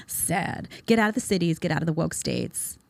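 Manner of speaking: ordinary talk, nothing marked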